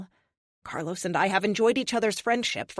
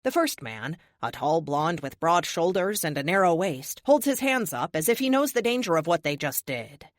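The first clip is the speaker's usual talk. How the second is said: ordinary delivery, nothing marked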